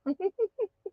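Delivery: high soft giggle; quiet little laugh; light laugh. Real, not acted. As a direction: giggle at her own joke. Host laughing.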